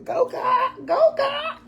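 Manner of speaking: very high, chanted cheer; cheering God on. Retold or imitated. Cheering God on as things work out, high chant. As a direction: cheer like a fan in the stands. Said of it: "So I be like".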